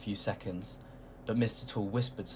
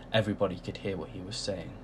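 ordinary delivery

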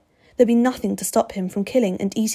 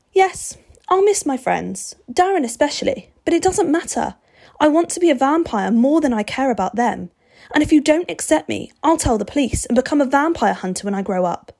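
put-on high, pressing, raised boyish voice; insistent boy, Steve; 6 semitones higher, 4 dB louder than usual. Boy arguing to join the vampire, high and insistent. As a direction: plead your case high and urgently.

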